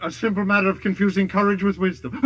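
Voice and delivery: normal talking voice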